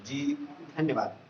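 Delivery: mildly timid, slow and soft thanks; understated meek reply; trembling, soft. A narrator's reading. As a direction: say it slowly and softly, a touch fearful. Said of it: "कांपते ही स्वर में".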